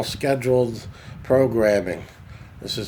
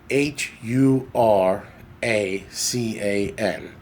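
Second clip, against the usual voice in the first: letters spelled out one by one, slowly; slow deliberate spelling; much slower than usual. Shown as a demonstration. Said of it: "and it's pronounced Huracan".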